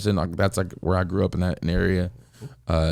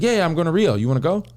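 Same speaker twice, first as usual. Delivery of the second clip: louder, high, eager quote; retold excited invitation; 10 semitones higher, 4 dB louder, faster than usual. Friend urging him along to Rio, excited. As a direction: talk him into it with high energy.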